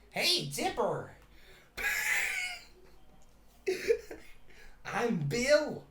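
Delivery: high, slow, cackling villain voice; gleeful menace, shooting up on the last word; Bill. Pretend. Triangle villain taunting Dipper, high-pitched mock menace. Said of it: "they finally beat Bill".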